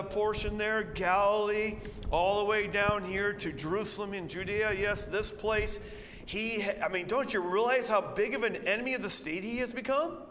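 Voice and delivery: ordinary talk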